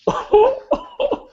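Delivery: a sudden high wailing sob; a mock weeping wail from the player; broken weeping. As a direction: let one sob break out high. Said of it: "begins to weep".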